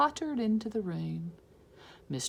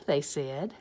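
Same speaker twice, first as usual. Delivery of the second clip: usual speaking voice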